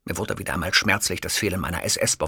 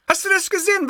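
shrill outraged question; 4 dB louder, faster than usual. Acted. Host ranting at producer.

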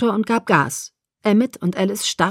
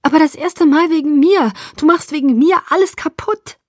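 high, agitated reproach; upset protest; 8 semitones higher, 4 dB louder than usual. A narrator's reading. Bella reproaches Edward for ruining everything, upset. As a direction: object in a raised, distressed voice.

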